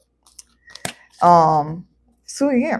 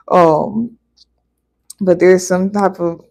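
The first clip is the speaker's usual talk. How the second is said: usual speaking voice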